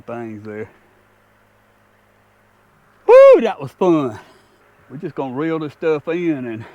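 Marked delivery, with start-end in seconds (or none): 3.1-4.2 loud, high, slowed whoop of real joy; excited whoop. Real, not acted. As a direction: cheer it out after the catch. Releasing the fish, thrilled.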